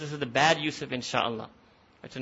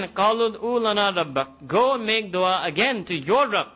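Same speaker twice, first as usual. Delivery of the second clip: insistent demand; 5 semitones higher, 5 dB louder, faster than usual. Retold or imitated. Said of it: "They said again".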